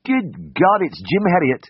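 high, astonished cry of recognition; surprised exclamation. A narrator's reading. Anaesthetist recognising his old schoolmate, astonished exclamation. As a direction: exclaim it in sudden amazement, pitch up. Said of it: "an exclamation from above me".

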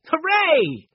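high, loud hooray; a boy cheering; cheerful shout. A narrator's reading. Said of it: "shouted Benny".

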